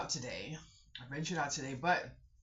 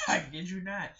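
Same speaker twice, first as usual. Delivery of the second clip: laughing; 4 semitones higher, 7 dB louder than usual. Real, not acted. Laughing at own story.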